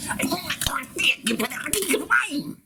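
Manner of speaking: very high, garbled whining screech; progressively incomprehensible tantrum. Acted. Pestering the cashier ever further, incomprehensible high whine. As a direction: squeal it till the words smear together. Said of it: "I get progressively incomprehensible".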